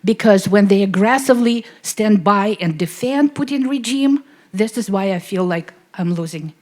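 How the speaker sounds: loud, pressing, near breaking; emotional plea. Real, not acted. Audience member pleads with Vladimir, loud and emotional. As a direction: push the question out loud, upset. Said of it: "I'm a little bit emotional".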